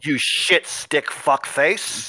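slow, drawn-out Scottish insult; insulting Scottish accent. Acted. Dwarf villain cursing the player slowly in Scottish accent. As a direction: spit the insult in a Scottish brogue. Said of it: "bad Scottish accent".

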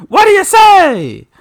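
high, slow, hammy referee shout; Roddy Piper impression. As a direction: draw it out like a ringside ref.

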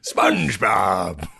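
slow, drawn-out cartoon pirate holler; Mister Krabs. Auditioning for Lauren at SNL, cartoon pirate shout. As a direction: call out like a crusty sea-crab boss. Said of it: "I'll be doing Mister Krabs".

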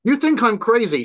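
high, loud shout. A narrator's reading. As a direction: shout it at him. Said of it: "she shouted at him".

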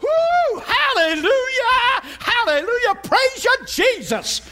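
very high, loud mock-girl hallelujah shriek; hammed-up joyful shouting, drawn out; shouted praise. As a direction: whoop it up like an ecstatic little believer. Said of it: "started shouting".

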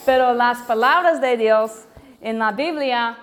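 Spanish with a strong American accent. Real, not acted. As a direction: speak Spanish as an American learner. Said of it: "disculpa mi español porque es no perfecto".

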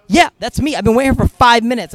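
loud, high-pitched indignant imitation; mock an impatient rider snapping back; retold exasperated yell; irate passenger. Retelling irate passenger waiting.

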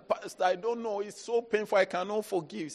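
high, soft mock lament; lightly voiced hurting member. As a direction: plead with the pastor in pain. Voicing a hurt member.

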